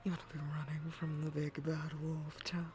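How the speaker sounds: low whispered lure; lightly voiced sly wolf. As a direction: tempt the child like a wolf. Wolf luring child.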